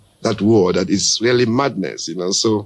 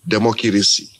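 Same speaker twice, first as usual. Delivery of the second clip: broken African English; 3 semitones lower than usual. Retold or imitated. [name] showing how an African man says the word in broken English. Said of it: "the African man wants to say it in broken".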